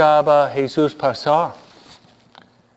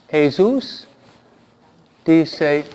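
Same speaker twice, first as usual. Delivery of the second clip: ordinary talk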